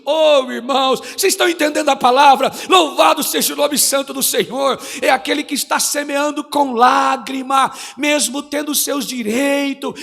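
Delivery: fervent shouted preaching, building. Real, not acted. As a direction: preach it loud to the congregation. Preaching at full force. (pregação inflamada, aos gritos)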